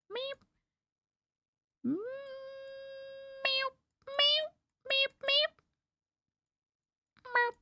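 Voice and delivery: high soft meowing; cat meows. Pretend. Hinting the drawing to chat, high soft cat meows. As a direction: meow like a little cat.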